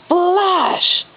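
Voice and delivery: plain reading voice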